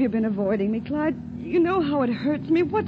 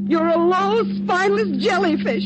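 loud, higher-pitched scornful shout; angry insult; 5 semitones higher, 4 dB louder than usual. Acted. Spurned woman insulting her lover in the car, angry shout. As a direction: spit the insult out at full voice. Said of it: "Stop shouting".

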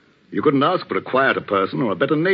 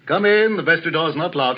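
raised, higher-pitched call; 5 semitones higher than usual. Acted. Vicar calls the expected visitor into the vestry, calling out. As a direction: call out to someone at the door.

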